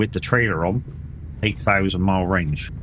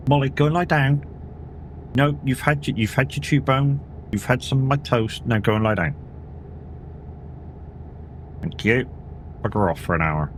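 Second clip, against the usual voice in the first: scolding the dog; 7 semitones higher than usual. Real, not acted. Telling the dog off.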